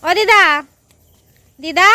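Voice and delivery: loud high call across the room; to someone hard of hearing. Real, not acted. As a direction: call out loud so she hears. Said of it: "দিদা কানে কম শোনে".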